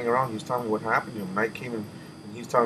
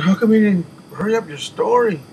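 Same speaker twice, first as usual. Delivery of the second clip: higher and louder urgent question; urgent demand; 8 semitones higher, 8 dB louder than usual. Retold or imitated. Demanding why his friend dragged out the story, loud and high. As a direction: press him hard, almost shouting. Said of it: "I said".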